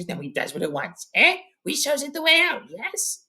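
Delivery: whispery creature hiss rising to a high squeal; Gollum. A narrator's reading. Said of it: "And Gollum goes".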